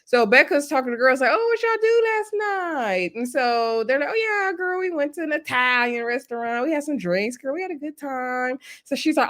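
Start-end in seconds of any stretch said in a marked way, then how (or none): 1.3-3.1 faux-innocent question. Becca fishing for info sweetly. 4.1-8.6 put-on chatty girlfriend voice. Girls gushing about their night.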